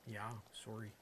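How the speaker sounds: low, quiet Canadian "sorry"; Canadian dialect. Shown as a demonstration. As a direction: say "sorry" softly with a Canadian rounded o. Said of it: "take on the friggin' dialect".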